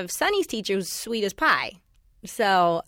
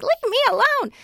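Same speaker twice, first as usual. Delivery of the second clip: mock old grandma. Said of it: "The old grandma".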